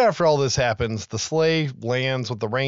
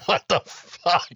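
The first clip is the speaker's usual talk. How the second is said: stunned what the fuck; 6 semitones higher, slower than usual. Retold or imitated. Stunned man witnessing chimney fiasco.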